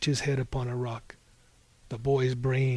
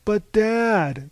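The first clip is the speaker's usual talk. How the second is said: higher, louder plea to a parent; whining protest; 6 semitones higher, 5 dB louder than usual. A narrator's reading. Cyclops answers his scolding father, pleading protest. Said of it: "the Cyclops protested".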